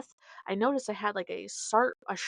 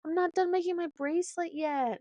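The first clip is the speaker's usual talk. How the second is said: put-on higher, softer pleading kid; lightly voiced little girl begging; whiny child; 7 semitones higher, 4 dB quieter, faster than usual. Daughter begging mom to stay, soft whiny child voice. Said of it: "And she's like".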